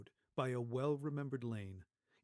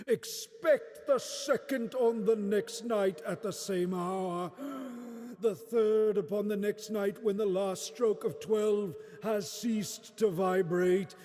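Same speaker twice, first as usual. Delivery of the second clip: ghost voice growing louder and higher, slowing to a wail; ghostly farewell building; 11 semitones higher, 12 dB louder than usual. Acted. Marley's ghost leaving Scrooge, building to a wailing cry. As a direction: swell from a grave warning to a long final cry.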